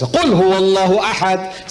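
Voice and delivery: quick clipped Arabic recitation, telegram-like; brisk Arabic chant-like reading; rapid Quran recitation. Retold or imitated. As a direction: rattle off the verse fast in Arabic. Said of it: "he doesn't talk like this".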